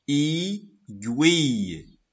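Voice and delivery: "aiguille" slowly split into "ai" and "guille"; syllable by syllable. Shown as a demonstration. Teacher showing first and second syllable, "aiguille" slowly separated. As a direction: draw out both syllables of "aiguille". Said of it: "Voilà la première syllabe".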